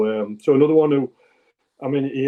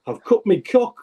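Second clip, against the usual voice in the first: put-on a light broad Yorkshire accent, higher pitched; 7 semitones higher than usual. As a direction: lightly imitate a Yorkshire lad's high complaint. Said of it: "in this broad, like, Yorkshire accent".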